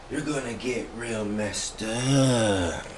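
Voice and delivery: very slow, soft stoner drawl; hippie voice. Pretend. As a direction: stretch it out like a laid-back hippie. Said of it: "In my hippie voice".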